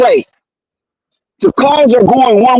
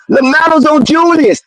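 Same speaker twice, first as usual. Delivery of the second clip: normal talking voice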